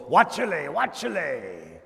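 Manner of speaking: mock Spanglish shout watchele then drawn out; Spanglish warning. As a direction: warn the lowrider driver in mock Spanglish. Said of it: "in Spanglish ... you go".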